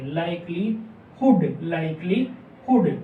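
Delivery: slow लाइक्लीहुड with a breathy h before हुड; careful demo pronunciation. Shown as a demonstration. Shorthand teacher to students, slow clear demo of the word. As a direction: draw out लाइक्लीहुड, sounding the h clearly. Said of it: "इस type से हम इसकी pronunciation करेंगे".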